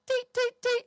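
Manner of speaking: very high, loud staccato peeps; squeaky high toots; flute toots. Acted. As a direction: imitate three quick flute notes. Imitates timed flute notes.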